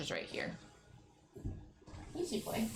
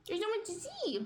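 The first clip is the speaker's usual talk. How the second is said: much higher, cooing sing-song. Real, not acted. Telling her curious dog there's so much to see, cooing. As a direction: echo it like talking to a puppy.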